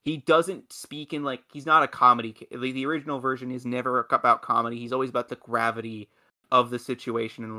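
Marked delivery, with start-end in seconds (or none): none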